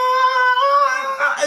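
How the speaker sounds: long high mock labour scream; a high retold scream, drawn out long; imitated scream. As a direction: hold a long yell like a woman pushing. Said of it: "She's like".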